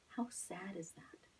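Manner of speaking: teacher whispering her dismay; hushed sadness. Real, not acted. Lamenting the servant's cruelty.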